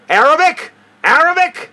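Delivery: mock officials bellowing; imitated yelling. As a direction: scream it at the old woman. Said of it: "they were yelling at her".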